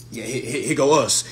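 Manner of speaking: ordinary talk, nothing marked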